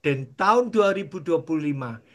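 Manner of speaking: low, soft prayerful voice; closing prayer. Acted. Pastor leading the congregation in closing prayer, soft. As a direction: pray it quietly and humbly.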